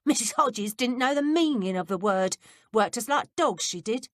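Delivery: put-on a touch brighter, grumbling boy; lightly voiced cheeky lad; lightly voiced servant boy. A narrator's reading. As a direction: complain like a put-upon houseboy. Peter voiced by reader.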